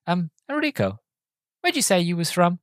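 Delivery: a hint of sly mock-casual asking; Wayne. Driver sounding out his passenger, lightly imitated casual question. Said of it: "he goes".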